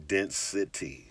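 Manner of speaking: Density drawn out very slowly and quietly; lingering slow take of Density; said real slow. Shown as a demonstration. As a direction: stretch Density out, slow and soft. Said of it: "Say it real slow".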